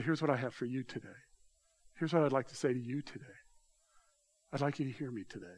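soft, quick, understated quoted voice; gentle imagined address. Acted. Holy Spirit speaking privately to a listener, softly voiced. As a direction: say it quietly, as a private word.

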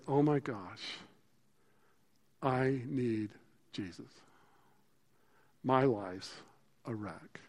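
slow, hushed plea from the heart; repentant plea. Acted. Repentant sinner asking God for help, hushed and slow. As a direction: pray it softly, broken and honest.

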